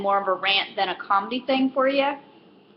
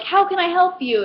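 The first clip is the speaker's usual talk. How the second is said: higher, effortful politeness; forced cheer; 8 semitones higher than usual. Retold or imitated. Clerk facing a difficult customer, forced cheerful greeting. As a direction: push out the greeting through gritted patience. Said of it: "extra ounce of strength".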